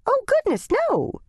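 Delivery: shocked denial, squeaky high; flustered. Acted. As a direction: church lady caught out, flustered and high. Flustered lady backpedals.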